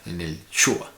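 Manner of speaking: Shua leaned on as the stressed syllable. Shown as a demonstration. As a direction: point at the Shua in en el Shua. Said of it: "debería colocarse en la segunda".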